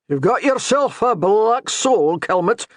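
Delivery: slow, teasing Scottish brogue; mocking Scottish brogue. A narrator's reading. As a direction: jab at him in a Scottish brogue. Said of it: "a hint of mockery in his thick brogue".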